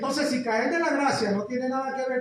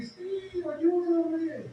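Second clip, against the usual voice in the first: tiny ventriloquist voice; 7 semitones higher, much slower than usual. Retold or imitated. Possessed man begging the pastor for help, tiny high voice. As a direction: squeak it out like a voice trapped within. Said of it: "una vocecita como un ventrílogo".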